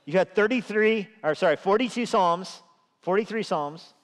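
urgent plea to God. Retold or imitated. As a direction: demand God's attention like a nagging child. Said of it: "It's like".

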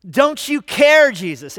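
slow loud cry on care, then dropping; pleading shout. Retold or imitated. Disciples crying out to Jesus, loud slow plea. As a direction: wail the question. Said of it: "That's their question".

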